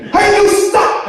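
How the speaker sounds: high shouted hey you; cop yelling. Retold or imitated. Police officer calling after the teller, shouted. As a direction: bark it like a cop on patrol. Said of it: "I speed up".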